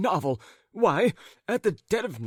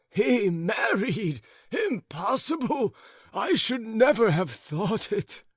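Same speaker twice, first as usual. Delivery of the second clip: surprised; 7 semitones higher, slower than usual. A narrator's reading. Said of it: "said the old gentleman".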